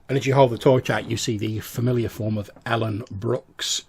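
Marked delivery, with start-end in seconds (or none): none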